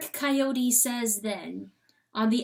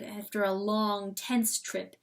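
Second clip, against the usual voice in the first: plain reading voice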